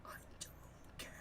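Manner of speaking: unvoiced whispered aside. Real, not acted. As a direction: whisper it under your breath.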